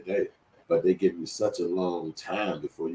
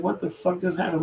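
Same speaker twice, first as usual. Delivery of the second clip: stunned thought; 13 semitones higher than usual. Retold or imitated. Thinking after the scare.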